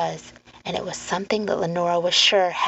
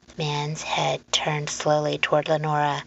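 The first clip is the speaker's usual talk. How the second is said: usual speaking voice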